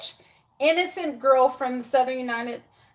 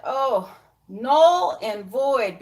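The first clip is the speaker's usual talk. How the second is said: slow verdict; much slower than usual. Real, not acted.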